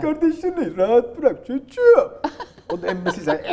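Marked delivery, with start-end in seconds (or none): 0.0-2.2 loud, slow dubbed-movie dad shouting; bağıran baba. Acted. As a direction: scold the son in a hammed-up dad voice. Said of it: "Baba bağırıyor işte". (bağıran dublaj babası sesi)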